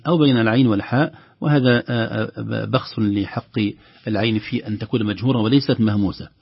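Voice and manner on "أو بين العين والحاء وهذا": normal talking voice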